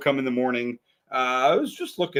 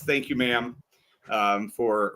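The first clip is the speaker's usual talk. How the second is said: normal talking voice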